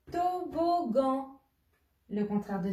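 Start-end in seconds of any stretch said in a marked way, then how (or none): 0.1-1.4 slow, drawn-out syllables, pitched high. Shown as a demonstration. Teacher revealing the charade's answer, syllable by syllable. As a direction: stretch each syllable as you reveal it.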